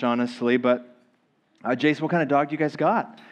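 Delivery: ordinary talk, nothing marked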